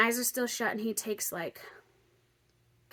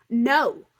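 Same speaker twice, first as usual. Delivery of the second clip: loud, separate no's; acted loud protest; emphatic refusal; 10 dB louder than usual. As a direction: refuse loudly, a pause between each. Refusing the next nostril, retold.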